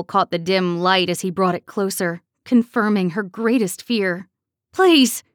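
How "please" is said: high, loud, gasping please; terrified gasped plea. A narrator's reading. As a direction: gasp it out in terror. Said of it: "she gasped".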